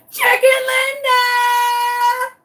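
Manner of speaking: very high, long-drawn yell for the lost one; screaming. Retold or imitated. Screaming for a lost chicken in the front yard, desperate. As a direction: holler like a parent searching for a lost child. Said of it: "screaming at the top of my lungs, like a parent who's lost their child".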